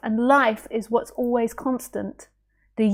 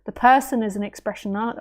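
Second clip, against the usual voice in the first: usual speaking voice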